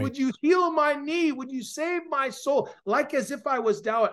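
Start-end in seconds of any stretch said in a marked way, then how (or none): 0.0-2.7 high-pitched, pleading prayer; urgent cry for mercy. Retold or imitated. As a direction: keep begging God, voice raised high. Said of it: "I just cried out".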